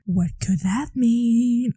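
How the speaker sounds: slow, drawn-out mysterious question; ominous tease. Pretend. Teasing viewers about Missus Dodds, slow and ominous. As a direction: linger on it to build suspense.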